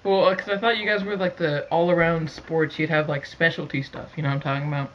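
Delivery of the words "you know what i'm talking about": ordinary talk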